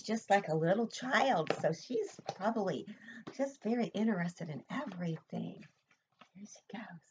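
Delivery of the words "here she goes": whisper. Real, not acted. Reading to child, suspense aside.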